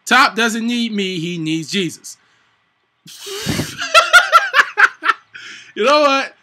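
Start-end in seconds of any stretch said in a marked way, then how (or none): 3.2-5.7 loud high laughing fit; cracking up at his own joke; high burst of laughter. Real, not acted. Streamer cracking up at his own joke, high laughter.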